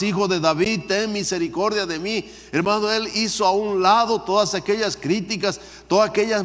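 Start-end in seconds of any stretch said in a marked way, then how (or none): 0.0-2.3 high, pleading outcry; crying out more. Retold or imitated. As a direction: lift the plea higher as he cries on. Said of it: "él clamaba mucho más".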